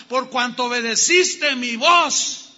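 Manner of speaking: God proclaiming the blessing to Abraham, loud; climactic proclamation. Acted. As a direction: declare it with full force. Jehovah's oath climax.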